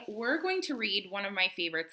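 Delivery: usual speaking voice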